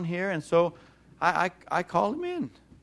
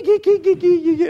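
teasing noises; 13 semitones higher, 8 dB louder than usual. Retold or imitated. Grandpa tickling and teasing child.

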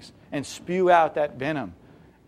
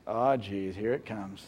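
mock resigned mutter, low and quiet; weary groan; 7 semitones lower, 10 dB quieter, slower than usual. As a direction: lower and softer, eye-rolling dread. Eye-roll reaction.

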